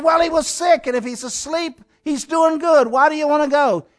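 high-pitched retold protest. Disciples talking Jesus out of going, protesting.

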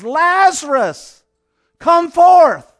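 high, loud, drawn-out call; commanding shout. Retold or imitated. Jesus calling his friend from the grave, commanding shout. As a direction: cry it out to wake the dead. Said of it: "He cried out".

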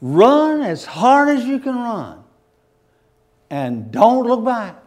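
urgent angel shouting run; angel's urgent command. Acted. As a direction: order them to flee, loud then drawn out. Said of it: "they said".

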